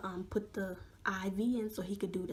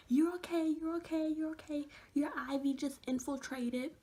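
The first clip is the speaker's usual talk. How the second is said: imitated reassuring nurse; 7 semitones higher than usual. Nurse to a panicking patient, imitated high reassurance. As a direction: mimic a nurse insisting all is fine, pitched up.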